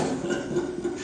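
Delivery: breathy mock goblin cackle; a hint of a mean goblin laugh. Goblin mocking the dwarf with a cackle. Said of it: "The goblin laughed".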